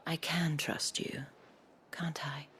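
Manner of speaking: breathy, teasing invitation; seductive whisper. Acted. Isabel teasing Clive, breathy and seductive. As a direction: draw him in with a hush.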